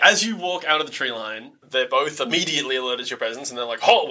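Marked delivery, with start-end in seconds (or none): 3.8-4.1 commanding shout. Acted. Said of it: "find some different voices for these guys".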